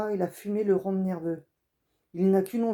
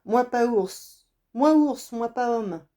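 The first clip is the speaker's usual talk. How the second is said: put-on higher, louder broken-speech child; insist, child who barely talks; defiant boy; 5 semitones higher, 7 dB louder, slower than usual. Wild boy insists to the hunter he is a bear, loud and defiant.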